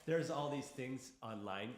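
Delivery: normal talking voice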